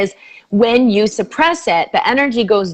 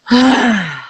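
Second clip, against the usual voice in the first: long released sigh; sighing out during the breathing exercise; audible exhale; 2 semitones higher than usual. Shown as a demonstration. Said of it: "exhale through the mouth".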